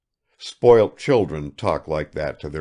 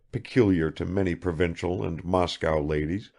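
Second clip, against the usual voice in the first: usual speaking voice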